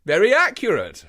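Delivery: mildly exclaimed praise; lightly voiced Holmes exclaiming. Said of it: "exclaimed Holmes".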